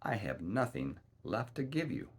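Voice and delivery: ordinary delivery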